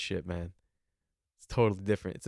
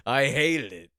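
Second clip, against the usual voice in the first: put-on louder gruff old-man pronouncement; old man dad voice; 2 semitones higher, 7 dB louder, slower than usual. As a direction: deliver it like a grumpy dad to the waiter. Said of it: "looks right at the waiter and goes".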